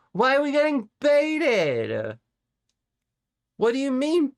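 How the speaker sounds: hammed-up high indignation; mock indignant squeal. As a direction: squeal in fake disbelief.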